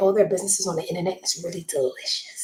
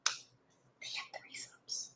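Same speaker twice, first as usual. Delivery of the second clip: hushed so the stepson won't hear; whisper; 20 dB quieter, much slower than usual. Real, not acted. Whispering so the kid behind the door can't hear. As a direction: drop to a secret whisper. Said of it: "hope my stepson doesn't have his ear against the door".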